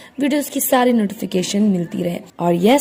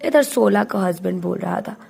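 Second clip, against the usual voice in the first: ordinary delivery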